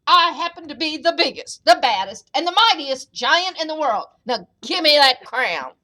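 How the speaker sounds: loud, forceful giant's boast; booming storybook bully; yelling giant. A narrator's reading. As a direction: proclaim it as the mightiest giant. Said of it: "he yelled".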